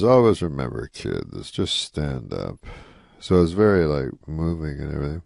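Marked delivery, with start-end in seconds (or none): 0.0-2.6 a solemn parting lesson; Rodney giving advice. Retold or imitated. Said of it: "He goes".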